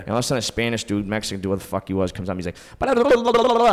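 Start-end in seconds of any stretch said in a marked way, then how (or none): 2.8-3.7 high, loud mock babble of a stranger; mock foreign gibberish. Imitating a stranger babbling at him, high and loud.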